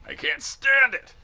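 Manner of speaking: slow, loud, high bitter cry; bitterly. A narrator's reading. Sergeant despairs over his recruits, bitter slow cry. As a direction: wail it out in bitter disgust. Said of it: "he said bitterly".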